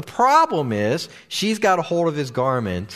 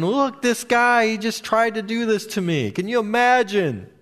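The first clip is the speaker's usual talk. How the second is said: high, raised voice of an outraged accuser; mock screaming; 7 semitones higher than usual. Preacher acting out Potiphar's wife yelling to her household, outraged. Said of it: "she's basically yelling and screaming".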